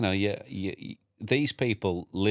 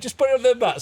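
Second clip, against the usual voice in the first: mum's voice; 12 semitones higher, 6 dB louder, much faster than usual. Retold or imitated. Mum voiced in story.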